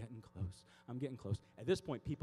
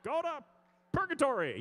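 loud, raised retort to hecklers; high exasperated shout; yelling back; 8 dB louder than usual. Retold or imitated. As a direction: holler it back at the honking cars. Yelling back at honking drivers.

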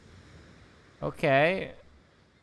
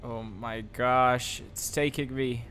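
slow amazed reaction; slower than usual. Real, not acted. Reacting to the warp sequence.

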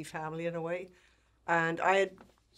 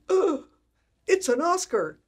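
high, loud gasp of amazement; mock astonishment, pitched way up and loud; astonished gasp; 12 semitones higher, 10 dB louder, slower than usual. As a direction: play the postman's big, startled discovery. Postman's shock at seeing Oscar.

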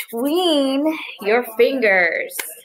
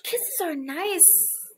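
tender; 6 semitones higher, 10 dB quieter, much slower than usual. Acted. Tender line to kids.

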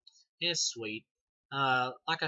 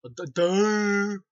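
slow, much higher and louder, a goofy dim-witted "duh"; a hammed-up idiot voice mocking the villain; mock dumb voice; 8 semitones higher, 12 dB louder, much slower than usual. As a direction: drag it out like a total dope. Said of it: "I'm like".

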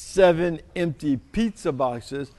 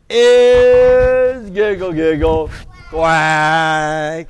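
slow, louder proclamation; drawn-out title call; 6 dB louder, much slower than usual. Shown as a demonstration. Reader repeating the title for the kids, slow and loud. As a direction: stretch every word out. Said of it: "once again".

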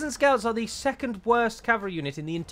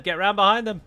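urgent louder order; 4 semitones higher, 5 dB louder than usual. Real, not acted. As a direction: shout the order louder the second time.

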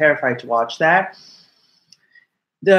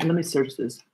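ordinary talk, nothing marked

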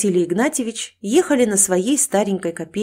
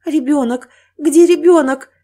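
high, loud panicked cry; wild fear; 7 semitones higher, 9 dB louder than usual. Acted. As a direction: cry out for your baby in terror. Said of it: "напал дикий страх".

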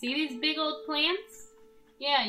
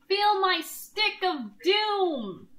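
slow, loud, higher villain boast; hammy battle cry; 7 semitones higher, 5 dB louder, slower than usual. Pretend. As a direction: bellow it like a cartoon hero.

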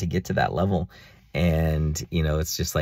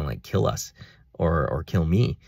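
normal talking voice

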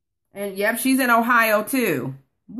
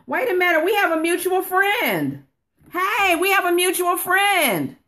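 much higher, delighted discovery; light up as the link clicks; excited surprise; 9 semitones higher than usual. Real, not acted. Finds a shared friend online.

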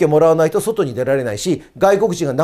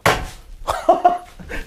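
breathy, voiceless laugh; short airy burst of real laughter. Real, not acted. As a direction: break into a breathy laugh mid-lecture. Breaks into laughter mid-lecture.

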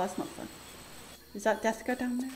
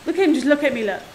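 ordinary talk

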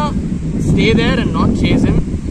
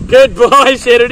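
bright, loud praise for the dog; excited praise; 4 semitones higher, 9 dB louder than usual. Real, not acted. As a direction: cheer the dog on, laughing.

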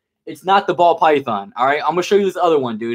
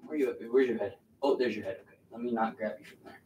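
soft coaxing; 5 semitones lower, 15 dB quieter, faster than usual. Real, not acted. Coaxing the snake very softly.

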